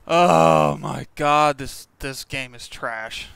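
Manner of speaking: raging gamer. Real, not acted. Rage at game, yelling.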